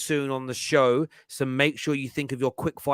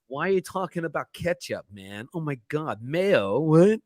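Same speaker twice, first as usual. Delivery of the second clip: mock American accent; Jessica. Host mimicking co-host over sauce, mock American accent. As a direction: put on an exaggerated American accent to tease. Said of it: "need to work on the Jessica".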